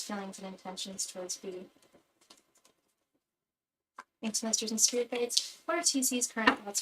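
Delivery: soft, repetitive invocation to spirits; prayer. Acted. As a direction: chant the question over and over. Repeated invocation to spirit guides.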